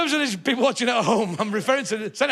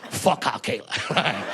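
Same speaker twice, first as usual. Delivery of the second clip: mock Russian accent; 5 semitones lower, 6 dB quieter than usual. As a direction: ham up a Kremlin aide's Russian accent. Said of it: "met Mister Putin and said".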